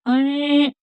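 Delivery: normal talking voice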